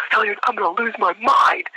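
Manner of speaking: high-pitched drill-instructor meltdown; mock exasperated yell. Sergeant in the candidate's face, mock exasperated yelling. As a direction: imitate a sergeant at the end of his rope. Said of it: "right in my face".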